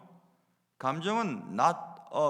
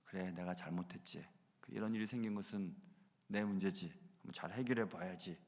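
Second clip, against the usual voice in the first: much softer and lower, talking to himself; soft, low inner voice of a man owning his fault; quiet self-reflection; 10 semitones lower, 12 dB quieter than usual. Pretend. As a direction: murmur it as an inward admission. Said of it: "자신을 먼저 돌아보았더라면".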